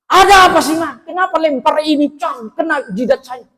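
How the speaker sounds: high, loud startled complaint; startled husband. Acted. Husband hit by charger, loud startled protest. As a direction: cry out like a man just hit awake.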